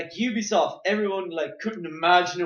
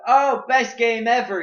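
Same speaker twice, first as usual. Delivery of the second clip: higher, louder imitation of gushing fans; mock gushing fans; 7 semitones higher, 8 dB louder than usual. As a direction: gush like an overexcited fan. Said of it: "there are people who are like".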